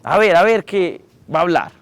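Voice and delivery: grandma calling the room to order; imitating grandma. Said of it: "decía".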